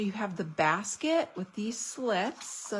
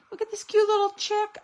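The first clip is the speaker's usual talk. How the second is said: cute-voice gush; 11 semitones higher, 6 dB louder, faster than usual. Real, not acted. Gushing over a chick stamp.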